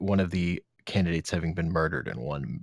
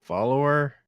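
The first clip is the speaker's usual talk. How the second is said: raised, higher call to a pet; calling an animal; 7 semitones higher, 4 dB louder than usual. Acted. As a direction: call out to get the animal's attention.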